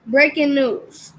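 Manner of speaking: ordinary talk, nothing marked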